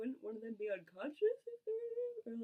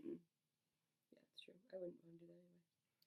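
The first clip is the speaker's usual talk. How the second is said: whispering; 5 semitones lower, 15 dB quieter, slower than usual. Real, not acted. Whispered reply.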